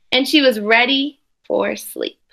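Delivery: put-on expressive read-aloud for children; storybook reading voice; story narration. A narrator's reading. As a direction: read the picture book to the class with care. Teacher reads picture book aloud.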